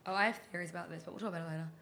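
usual speaking voice

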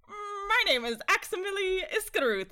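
high, stiff nerdy robot voice. Retold or imitated. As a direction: do a nerdy Power Rangers robot. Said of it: "like, nerdy voice".

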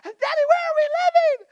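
put-on high little-kid voice asking; child's voice. Father voicing his kids asking where they will live, high child voice. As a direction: pitch up like a worried child.

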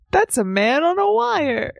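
high, drawn-out mock title; hammed-up imitation, high and slow; playful echo. Echoes the dad's mistaken title.

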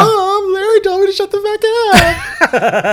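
put-on high whiny childish voice; whiny kid voice. Friend mocking a child tattling, high whiny. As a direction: whine it like a wounded little kid.

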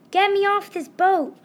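high, loud demand to escape; panicked scream. A narrator's reading. Ralph is stuck on the pirate boat, screaming. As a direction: shout to get off right now. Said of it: "Ralph screamed".

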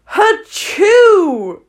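loud high fake sneeze. Shown as a demonstration. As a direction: let out a big sneeze. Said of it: "that was my attempt at a sneeze".

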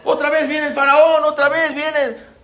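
high-pitched alarmed wail; imitated crying children. Children seeing Pharaoh return, mock tearful cry. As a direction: stretch it out like children calling for dad. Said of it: "Y empezaron a llorar".

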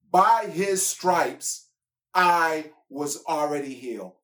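slow, loud declaration; proclaiming loudly. Acted. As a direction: proclaim it to the congregation. Proclaiming scripture.

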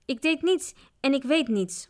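ordinary delivery

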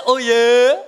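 loud, drawn-out rising oh yeah; guys' cheer. Shown as a demonstration. Speaker cues the men in the audience, loud approval. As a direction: lean into a showy approving oh yeah. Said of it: "Guys say".

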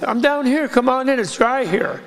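high little voice calling up. Retold or imitated. Guru calling from inside a yak horn, high and tiny. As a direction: squeak it up like a tiny hidden voice. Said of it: "he hears this little voice".

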